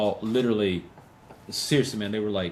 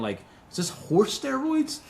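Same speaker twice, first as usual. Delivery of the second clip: incredulous; 12 semitones higher than usual. Retold or imitated. Asking gym guys what they take.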